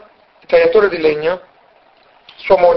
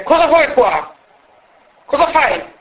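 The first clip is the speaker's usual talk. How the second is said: gruff woodcutter; 7 semitones higher than usual. Acted. Woodcutter confronts stranger at door.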